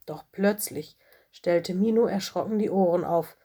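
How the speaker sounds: ordinary delivery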